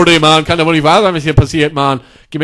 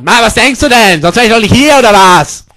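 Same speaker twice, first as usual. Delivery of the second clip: loud, raised-voice retort; indignant yelling; 3 semitones higher, 4 dB louder than usual. Acted. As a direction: yell it at the doctor, fed up. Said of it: "Schreit sie mich nicht so an". (empört angeschrien)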